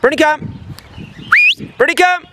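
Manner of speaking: high, loud repeated recall; calling out. Real, not acted. Trainer calling the runaway dog back, loud and high. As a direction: shout her name to bring her back.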